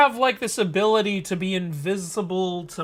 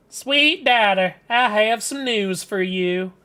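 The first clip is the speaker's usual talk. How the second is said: Southern mother. Acted. Mother telling her daughter about a husband search, Southern accent. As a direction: play a Southern mother announcing news. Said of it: "don't ask why I'm making them Southern".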